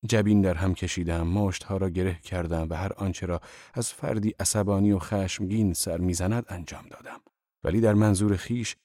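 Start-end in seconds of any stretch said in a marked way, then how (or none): none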